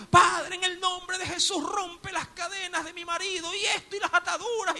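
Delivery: high, loud shouted plea. Retold or imitated. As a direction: cry out to heaven in full force. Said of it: "más fuerte lo hacía".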